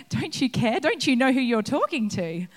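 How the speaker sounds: indignant outburst. Acted. Listener objecting to Martha's words, mock outrage. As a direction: play the shocked onlooker. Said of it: "the temptation there is to go".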